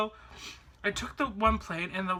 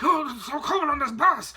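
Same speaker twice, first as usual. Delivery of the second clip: high, loud mock shivering complaint; freezing; 6 semitones higher, 6 dB louder than usual. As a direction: wail as if freezing on the spot. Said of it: "it's, like, freezing cold there".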